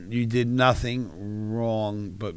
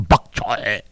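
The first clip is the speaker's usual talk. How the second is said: mock Chinese accent; 3 semitones lower, 5 dB louder than usual. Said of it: "You're going".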